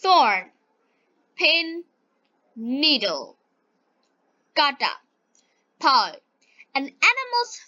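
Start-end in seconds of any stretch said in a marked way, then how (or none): none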